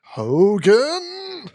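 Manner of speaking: a hammed-up German guard yelling "Hogan"; prison guard catchphrase. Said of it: "Her famous catchphrase".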